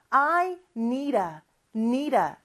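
"need a" run together as one word; "need a" said like "nee-da"; linked "need a". Shown as a demonstration. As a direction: link the D of "need" into "a". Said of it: "마치 하나의 단어처럼 발음이 되거든요".

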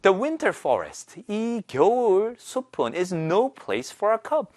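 very slow, careful English reading; slow lesson reading. Shown as a demonstration. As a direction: read it slowly for students to follow. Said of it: "같이 바로 한번 만나볼까요".

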